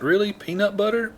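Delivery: high, incredulous query; baffled questioning. Retold or imitated. Asked to say it for coworkers, baffled. Retelling his baffled repeat on request.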